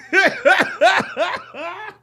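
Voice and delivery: high, loud giggling fit; hysterical laugh. Real, not acted. Cracking up at video clip.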